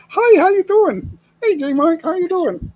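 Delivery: high, loud sugary greeting; fake-friendly local. Imitating locals who greet J Mike before a scam, high and sweet. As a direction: pitch it way up like an overfriendly stranger.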